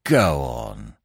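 low-pitched, dismayed disbelief; mildly aghast, dropping the pitch right down; consternation. A narrator's reading. Prisoner hears his likely sentence, consternation. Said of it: "exclaimed Mister Billy Marks in consternation".

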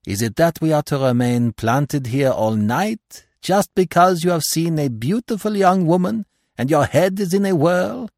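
gentle irony, pitch climbing high; Poirot. A narrator's reading. Poirot teases his smitten friend, gently ironical. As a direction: tease with French-accented, rising question. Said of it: "in his gentle, ironical voice".

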